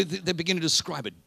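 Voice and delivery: ordinary talk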